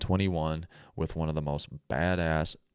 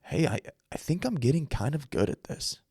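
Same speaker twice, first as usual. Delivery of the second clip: remembered inner thought; 8 semitones higher than usual. Retold or imitated. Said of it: "where I finally thought".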